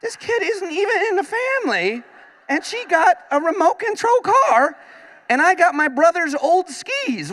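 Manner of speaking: put-on very high, indignant kid whine; jealous kid. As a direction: complain like a cheated child at Christmas. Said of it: "I was like".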